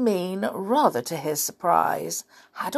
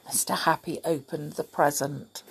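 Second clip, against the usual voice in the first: ordinary delivery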